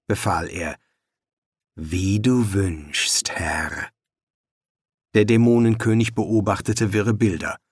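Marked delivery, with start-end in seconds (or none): 1.7-3.9 slow, low, hollow servant voice; bow low and drone it; servile mirror voice. Acted. Magic mirror answers demon king.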